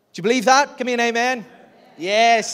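calling out. Real, not acted. Preacher calling his congregation to answer, loud rousing call. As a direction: rouse the room for a response.

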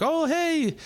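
cheery parking-lot enforcer, high and friendly; cart cop impression. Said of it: "he'll run up and be like".

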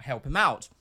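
ordinary talk

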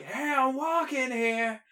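slow, high, drawn-out New York bark; hammed-up New York accent; New York tough guy. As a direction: do the classic New York cabbie shout. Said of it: "the one who's like".

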